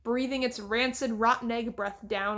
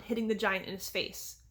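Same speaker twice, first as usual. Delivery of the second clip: ordinary delivery, nothing marked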